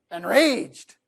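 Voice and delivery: slow, heavily drawn-out fury; mock rage. Speaker parodying an enraged moment, slow and exaggerated. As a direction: stretch the word out in hammed-up anger. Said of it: "when you're really upset".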